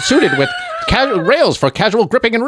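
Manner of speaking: hammed-up forties newsreel voice; newsreel announcer. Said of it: "that newsreel vo".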